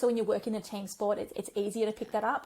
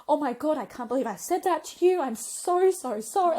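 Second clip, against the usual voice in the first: higher, louder pleading apology; frantic mock remorse, pitched high; mock tearful apology; 6 semitones higher, 6 dB louder than usual. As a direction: replay a teen's panicked sorry. Retold boy in tears apologising.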